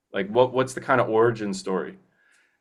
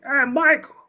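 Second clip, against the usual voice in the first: high-pitched character impression; put-on movie character voice; Frank Pantangeli; 14 semitones higher than usual. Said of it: "I don't know if that's a good impression".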